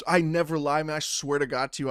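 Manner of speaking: ordinary talk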